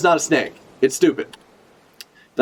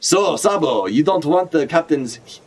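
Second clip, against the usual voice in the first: Ivankov voice; 2 semitones higher than usual. Retold or imitated. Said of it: "that's how she talks".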